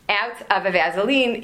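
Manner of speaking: ordinary delivery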